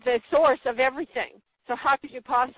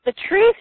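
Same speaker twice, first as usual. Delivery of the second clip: "The truth" said much higher and louder; emphatic truth; 10 semitones higher, 9 dB louder than usual. Real, not acted. Preacher hammering home his main point, loud emphasis on "truth". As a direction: hit "truth" hard and high. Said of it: "stress on truth".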